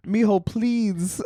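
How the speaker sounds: soft, high, drawn-out plea. Retold or imitated. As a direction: plead sweetly like a nice older lady. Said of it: "the sweetness of their voice when they are nice".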